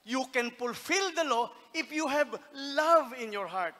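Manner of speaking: slow, loud proclaiming; loud slow sermon peak; fervent preaching. Acted. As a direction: preach it with fire. Preaching at full force.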